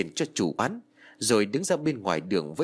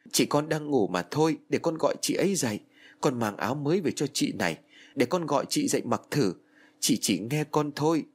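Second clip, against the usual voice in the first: desperate denial; 4 semitones higher than usual. Acted. Girl refusing to accept her sister is dead, desperate. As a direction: push everyone away, refuse to believe.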